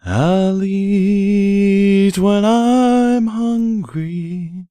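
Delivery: usual speaking voice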